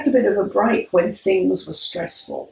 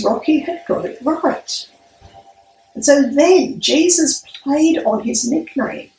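ordinary talk, nothing marked

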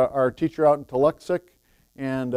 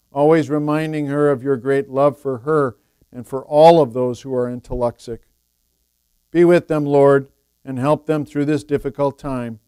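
praying aloud; 9 dB louder than usual. Acted. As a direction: lead the congregation in prayer. Leads prayer and Lord's Prayer.

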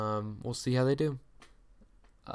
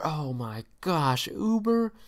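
exasperated outburst; 4 semitones higher, slower than usual. Real, not acted. Host venting at Uber before earnings, exasperated yell. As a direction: blurt the name out in disgust. Ranting about money-losing Uber.

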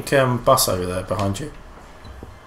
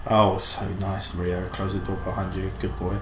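softly cooing to the cat; cooing to a pet; 5 semitones lower, 6 dB quieter than usual. Real, not acted. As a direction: coax the cat gently.

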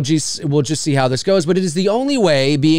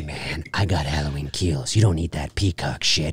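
low, hushed sales pitch; shady dealer; 11 semitones lower, 6 dB quieter than usual. Acted. Trench-coat guy pushing a bootleg movie, furtive and hushed.

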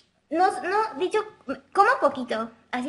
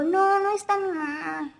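put-on high, slow, childlike answer on the phone; little-girl phone voice; 5 semitones higher, slower than usual. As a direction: answer a caller like a small child. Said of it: "Bueno, con mi voz". (voz de niñita al teléfono)

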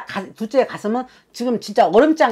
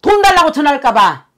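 high, loud pleading lament; 7 semitones higher, 8 dB louder than usual. Acted. Channelled sister-in-law telling the family her grief, loud and high. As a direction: pour out the dead woman's hurt at full voice. Shaman voices the dead woman's lament.

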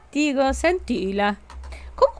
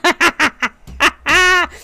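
scheming laugh; 5 semitones higher, 6 dB louder than usual. Real, not acted. Scheming laugh after idea.

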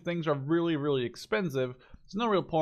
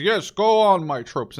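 commander voice; 4 semitones higher, 8 dB louder than usual. Pretend. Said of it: "like, the commander, says".

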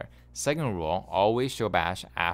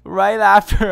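loud outburst; 10 semitones higher, 13 dB louder, slower than usual. Real, not acted. Coach blurting out the taunt timing.

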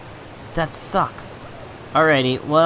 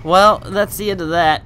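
rueful laugh; 6 semitones higher, 5 dB louder than usual. Real, not acted. Laughing after losing everything.

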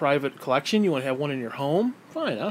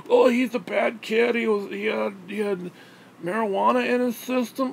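mocking critics; 6 semitones higher than usual.

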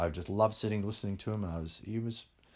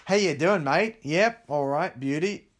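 higher and louder, rising Aussie greetings; broad Australian accent; 7 semitones higher, 7 dB louder, slower than usual. Shown as a demonstration. British boy sounding Australian to fit in, broad Aussie accent. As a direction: sing out mate like a true Aussie. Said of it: "speak like this and go up".

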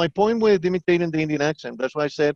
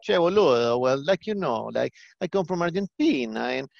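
put-on swooping, sing-song Argentinian accent; 3 semitones higher than usual. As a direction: imitate an Argentinian asking someone to repeat. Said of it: "down below to Argentina".

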